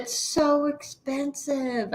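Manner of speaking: repeating the complaint under breath; weary repeat. Real, not acted. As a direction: let it sag. Repeat, dropping off.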